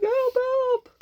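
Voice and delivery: a panicked squeal for help; squeaky mock cry for help. The hero fleeing the monster, squealing high. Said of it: "He's going".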